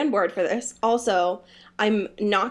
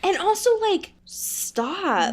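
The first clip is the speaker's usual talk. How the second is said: much higher, pleading stop; exasperated plea; 9 semitones higher, slower than usual. Acted. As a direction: beg the author to quit. Mock telling author to stop.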